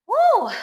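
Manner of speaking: high, loud cheering woo; loud whoop. Real, not acted. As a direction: whoop with relief. Relief after a hard set.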